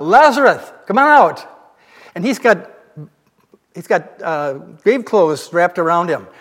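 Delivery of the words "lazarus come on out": louder, higher call to Lazarus. Retold or imitated. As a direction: call him out of the tomb, loud and high. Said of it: "And he calls out".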